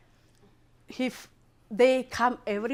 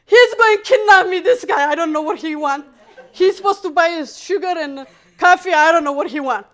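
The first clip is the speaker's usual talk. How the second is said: louder and higher, pleading to the brothers; frightened call to the brothers, raised and high; alarmed call for help; 8 semitones higher, 12 dB louder than usual. Retold or imitated.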